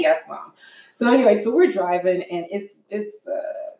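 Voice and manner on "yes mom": high-pitched mock-patient reply; put-on dutiful daughter voice; exasperated daughter. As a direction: answer a fussing mother, acted. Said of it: "calling me every hour on the hour".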